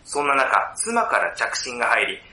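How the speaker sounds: measured film-story narration with drawn-out phrase endings; synopsis narration. Acted. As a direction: narrate the plot deliberately, pausing between phrases. (あらすじを読み上げるナレーション調)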